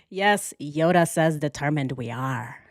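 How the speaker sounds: ordinary talk, nothing marked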